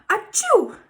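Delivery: loud high achoo; sneeze. Acted. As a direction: sneeze it out hard. Gerald sneezing, can't stop.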